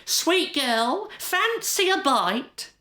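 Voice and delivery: high put-on disguise voice, drawn out; fake accent. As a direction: sweet-talk her in a high, fake, unrecognisable voice. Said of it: "putting on a fake accent".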